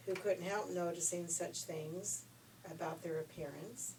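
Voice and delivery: ordinary delivery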